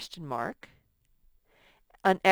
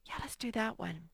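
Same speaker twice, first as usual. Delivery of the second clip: whisper; 3 semitones higher, 8 dB quieter than usual. Real, not acted. Aside while writing.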